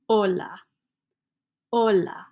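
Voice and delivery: hola said as "ola", no H sound at the start; silent H in hola. Shown as a demonstration. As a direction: drop the H: "ola". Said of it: "Don't pronounce H's in Spanish".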